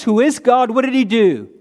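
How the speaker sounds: ordinary talk, nothing marked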